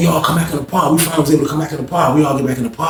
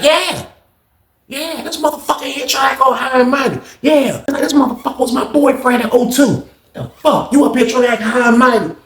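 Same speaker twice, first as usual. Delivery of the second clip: pitched-up mock tattling; high teasing voice; 9 semitones higher than usual. [name] mocking Lawrence to everybody, high teasing voice. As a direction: tease it up high.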